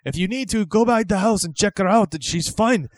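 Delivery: exasperated comic Irish son; Irish accent. Acted. Son telling mother to check, impatient Irish accent. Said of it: "all of a sudden he's Irish".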